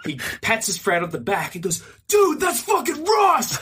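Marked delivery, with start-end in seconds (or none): 2.1-3.6 high, slow, drawn-out yell; mock excited shout. Guy alerting his friend on the escalator, imitated shout. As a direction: yell it to your friend in disbelief.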